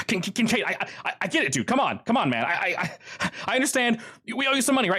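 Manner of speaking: high, stammering panic; panicked pleading. Acted. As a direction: beg him breathlessly not to burn you.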